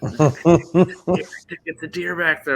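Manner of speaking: put-on high, loud, excited child's exclamation; imitating excited kids. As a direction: play a little boy thrilled at a sighting. Voicing his excited young sons.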